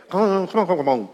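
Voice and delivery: mock-baffled protest; consternation. Congregation objecting to a confusing verse, in mock consternation. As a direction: protest in mild, put-on disbelief. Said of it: "our consternation comes when we look at that and we say".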